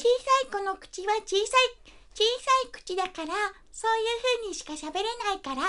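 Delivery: high voice from the front of the mouth; small mouth, little child. Shown as a demonstration. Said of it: "前の方だけで喋るっていう".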